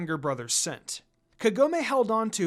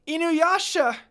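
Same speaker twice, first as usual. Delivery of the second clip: much higher, louder cry of his name; desperate yell; 11 semitones higher, 7 dB louder, slower than usual. Acted. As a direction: scream for him as you run. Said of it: "Kagome yelled more".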